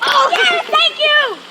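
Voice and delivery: high, loud delighted cheer; excited cheering. Real, not acted. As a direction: celebrate out loud, thrilled. Driver honked, kids cheer.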